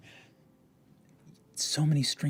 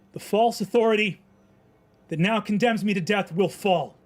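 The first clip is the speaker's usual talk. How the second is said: high, loud, ringing defiance to the crowd; defiant proclamation; 4 semitones higher, 8 dB louder than usual. Acted. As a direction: shout down the false rulers. Defiant last speech to crowd.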